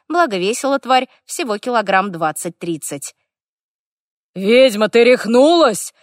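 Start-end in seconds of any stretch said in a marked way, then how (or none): 4.4-5.9 loud roaring orc voice. A narrator's reading. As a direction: roar it across the wasteland. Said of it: "рык орка".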